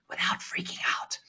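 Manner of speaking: hushed, voiceless whisper; whispered aside. Shown as a demonstration.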